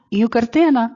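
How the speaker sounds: ordinary talk, nothing marked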